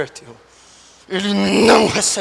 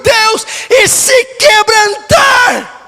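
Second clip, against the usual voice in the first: impassioned preaching; 12 semitones higher, 8 dB louder than usual. Real, not acted. Pastor pleading with God for the lost, impassioned preaching. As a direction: preach at full force, stretching the key words.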